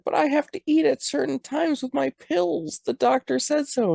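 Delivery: a high, put-on old mom begging to eat; whiny old mom. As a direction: plead like a hungry old mother. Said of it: "And she's like".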